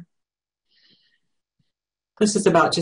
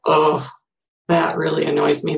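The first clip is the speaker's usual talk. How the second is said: normal talking voice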